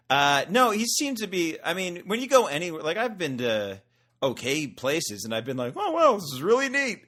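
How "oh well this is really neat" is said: much higher and louder, hammed-up delight; mock enthusiasm. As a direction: gush like an easily impressed tourist.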